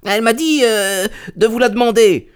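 high, timid pleading; whining little man. Acted. Little man pleads with angry merchant.

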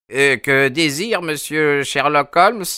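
old Sherman voiced high and curious; curious old man. A narrator's reading. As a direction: ask what Holmes wants, intrigued.

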